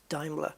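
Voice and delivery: Daimler as DIME-ler; correct pronunciation. Shown as a demonstration. Gamer wondering at the discovery, Daimler as DIME-ler. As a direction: say Daimler with an eye vowel. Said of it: "Apparently it's Daimler".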